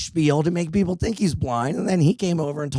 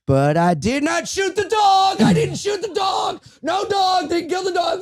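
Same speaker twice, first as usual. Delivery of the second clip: mock shout. Subway singer yelling her changed lyric at him, high and shouted. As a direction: bellow it like a street singer gone off-script. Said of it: "she went".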